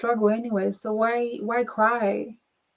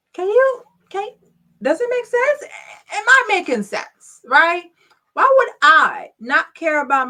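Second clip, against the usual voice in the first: much higher and louder, urgent; worked-up plea for agreement; excited pleading; 7 semitones higher, 6 dB louder than usual. Real, not acted. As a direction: push them to agree, voice climbing. Pressing the audience for agreement.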